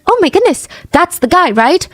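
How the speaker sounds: high, bright obvious-guy exclaim; mock realization. People realizing he is the killer, high mock surprise. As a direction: pitch shoots up, mock shocked. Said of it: "people would be like".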